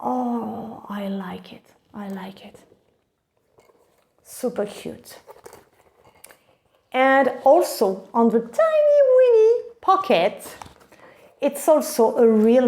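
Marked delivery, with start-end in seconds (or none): 0.0-5.2 soft gasp melting into a whisper; awed whisper. Real, not acted. Vlogger awed by the soft leather, hushed whisper. 8.4-9.6 high, cutesy sing-out; cutesy squeal. Real, not acted. Vlogger showing the bag's small pocket, cutesy squeal.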